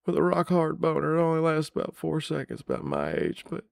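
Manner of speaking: Clinton slyly admitting he laid the curse; Bill Clinton impression. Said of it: "Think Bill Clinton might have cursed me".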